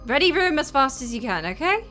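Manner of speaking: loud, brisk-spirited command; giving orders. Acted. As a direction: call out the order to the whole crew. Ordering everyone to hurry over.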